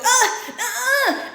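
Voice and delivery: high, whiny mock cries of refusal; hammed-up high whimper; mock wailed "ah". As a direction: wail short protesting ahs. Acted balking at warning signs.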